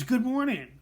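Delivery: loud, high mock-scream of a greeting. Coworker greeting him in the break room, screaming. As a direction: belt it out like an overeager coworker. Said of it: "she screams".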